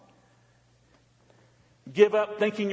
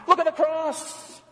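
fervent cry; 9 semitones higher, slower than usual. Acted. Preacher's rising refrain.

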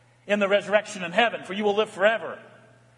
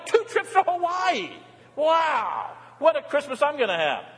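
much higher, joyful shout; excited shout; 7 semitones higher than usual. Real, not acted. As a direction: whoop with delight at the gift. Delighted shouts at kids' gifts.

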